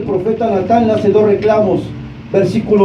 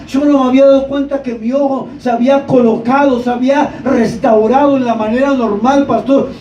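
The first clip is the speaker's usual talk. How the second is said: higher-pitched, amazed retelling; excited testimony; 3 semitones higher than usual. Retold or imitated. Healed young man tells his pastor of the miracle, excited. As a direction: act out an astonished young man's testimony.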